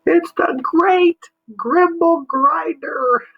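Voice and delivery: very high, loud, slow alien cry; excited exclaim. Acted. Marsman announcing the monster, high excited cry. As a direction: cry out the monster's name in awe. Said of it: "the Marsman exclaimed".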